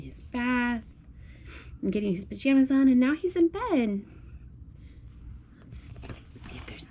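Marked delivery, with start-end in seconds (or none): 5.6-6.9 soft bedtime whisper; hushed whisper. Real, not acted. Mom whispers to her toddler while turning the page. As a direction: whisper so the little one stays calm.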